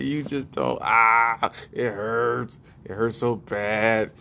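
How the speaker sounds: lightly voiced wife in pain; mock aching moan; complaining wife. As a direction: cry out on "ah", then moan the complaint.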